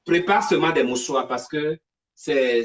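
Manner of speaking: ordinary delivery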